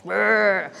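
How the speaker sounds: a brisk shivering brr; playful disgusted brr; mock shudder. Shudder at stuck taste.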